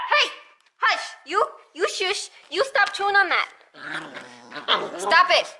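loud, high scolding shout. Real, not acted. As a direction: snap at the chewing pets. Scolding pets chewing.

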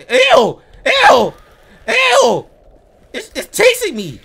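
high disgusted shrieks; scared horror game streamer. Real, not acted. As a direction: squeal in panic while fleeing. Monster chasing player.

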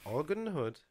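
usual speaking voice

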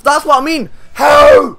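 very loud, high exasperated yell; outraged shout. Real, not acted. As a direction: shout it at the unfair game. Losing again, outraged.

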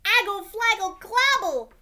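put-on high, loud baby babbling; toddler babble. Trixie babbles urgently at her daddy, high squeal. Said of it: "Trixie turned to her daddy and said".

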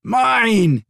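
a beast thrilled with its catch; joyful dragon crowing over its prize; delighted dragon. A narrator's reading. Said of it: "his dragon replied with delight".

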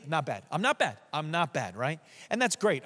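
ordinary talk

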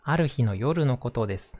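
usual speaking voice

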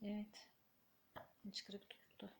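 soft whispered Hıçkırık tuttu. Real, not acted. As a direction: breathe it under the voice. Whispers again.

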